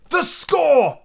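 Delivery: high, startled sailor's exclamation; sudden outburst. Acted. Sea cook suddenly remembers his unpaid score, bursting out. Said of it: "he burst out".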